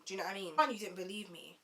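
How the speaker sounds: normal talking voice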